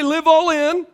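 preacher leading the chant; call to repeat. Real, not acted. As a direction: lead the room in the refrain. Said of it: "everybody say".

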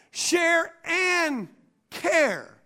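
"Share and" held high, then a pause before "care"; emphasis on care. Shown as a demonstration. Preacher showing the congregation his emphasis, "care" set apart. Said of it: "Notice what I put the emphasis on".

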